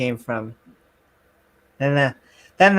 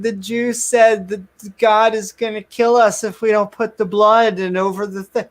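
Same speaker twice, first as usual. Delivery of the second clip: put-on higher, kid-like whine; whiny Cartman; 6 semitones higher than usual. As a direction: plead like a scared boy tattling. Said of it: "And Cartman's like".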